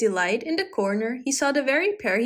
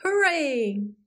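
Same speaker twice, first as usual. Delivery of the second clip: higher-pitched hooray, drawn out and falling; gleeful cheer; 4 semitones higher than usual. A narrator's reading. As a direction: let out a happy cheer, not shouted. Cat cheers while skipping off.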